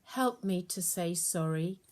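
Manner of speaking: ordinary delivery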